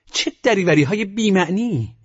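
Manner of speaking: high-pitched exclamation of scorn; indignant cry. A narrator's reading. She cries out at the narrator's wild confession, indignant. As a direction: cry out in sharp, high exasperation. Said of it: "فریاد زد".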